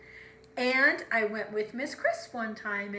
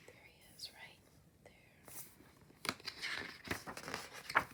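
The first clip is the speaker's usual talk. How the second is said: very soft pointing whisper; hushed reveal of the hidden crab; 15 dB quieter than usual. Acted. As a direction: whisper it as you point him out.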